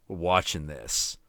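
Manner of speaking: low, quiet voice laying down the law; retold firm friend. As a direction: imitate a friend's calm insistence. Retold friend giving firm order.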